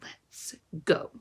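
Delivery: low unvoiced whisper; boy quietly urging departure. A narrator's reading. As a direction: breathe it out grimly.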